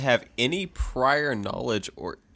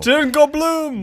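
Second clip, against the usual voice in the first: high, loud, drawn-out announcement; mock-triumphant shout; 10 dB louder, much slower than usual. Co-host echoing the episode rating, mock-triumphant shout. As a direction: holler the score like a big verdict.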